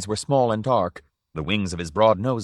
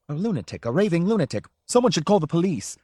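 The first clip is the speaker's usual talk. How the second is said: rising, higher shrill alarm; alarmed outburst; 8 semitones higher than usual. A narrator's reading. Owner crying out that Drioli is mad, rising. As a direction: panic at the madman.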